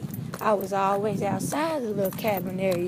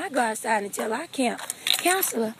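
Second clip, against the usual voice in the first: ordinary talk, nothing marked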